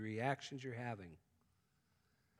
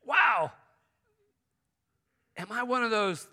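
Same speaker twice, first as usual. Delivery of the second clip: higher and louder, alarmed at himself; shocked self-doubt; 9 semitones higher, 13 dB louder than usual. Retold or imitated. Preacher voicing his past shocked inner thought. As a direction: blurt it out like a sudden scary thought. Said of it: "I'm thinking".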